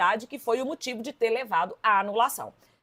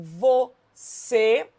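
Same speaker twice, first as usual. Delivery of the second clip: "você" very slow, vo, then CÊ; syllable by syllable; 4 dB louder than usual. Shown as a demonstration. Teacher locating the stressed syllable, "você" split slowly. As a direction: stretch "você" into two parts, stressing CÊ. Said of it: "a sílaba tônica recaiu sobre a última".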